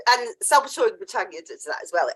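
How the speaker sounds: usual speaking voice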